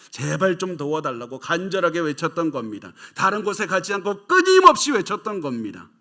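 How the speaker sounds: rising from firm to a loud, high outcry; building preaching cry. Acted. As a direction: build it up until you are nearly shouting. Preacher retelling woman's desperate cry. (점점 높아지며 외치듯)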